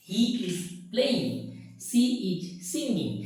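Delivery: put-on each sentence drawn out very slowly for kids to repeat; slow, patient teacher reading example sentences; slow dictation. Shown as a demonstration. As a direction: read it word by word so the class can follow. Slow model sentences for class.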